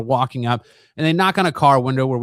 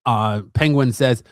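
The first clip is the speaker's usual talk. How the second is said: ordinary delivery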